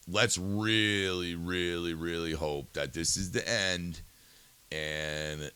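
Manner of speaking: sincere hope. Real, not acted. Host closing his eulogy for Charlie Kirk, softly sincere. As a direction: let it settle, truly hoping.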